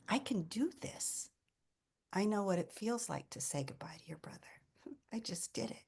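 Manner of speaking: soft, near-whispered self-assurance; hushed inner thought. Retold or imitated. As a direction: quietly tell yourself, as in a private thought. Retold private thought at the bedside.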